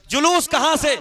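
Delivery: जुलूस called out high and loud; rallying shout of जुलूस; shouted question. Shown as a demonstration. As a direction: fling जुलूस कहां से at the crowd. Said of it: "आखिरी point बस".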